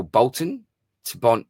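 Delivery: ordinary delivery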